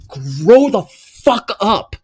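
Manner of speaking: high, loud, slow scolding. Acted. Scolding grown adults, slow and loud. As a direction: spell it out to them.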